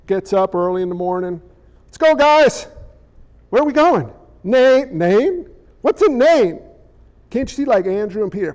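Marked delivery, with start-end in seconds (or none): 1.9-6.6 lightly acted confused disciples; quick back-and-forth between puzzled disciples; voiced disciples bickering. Disciples asking each other where they're headed, puzzled. Disciples bantering in retold scene.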